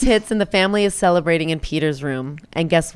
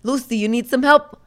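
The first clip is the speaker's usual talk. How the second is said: tough-guy Joe Junior; 4 semitones higher, 6 dB louder than usual. Retold or imitated. Joe Junior stepping in to protect Lucy, hammed-up tough guy. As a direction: play the clumsy would-be hero.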